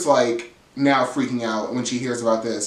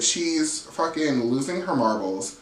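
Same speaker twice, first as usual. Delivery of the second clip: ordinary talk, nothing marked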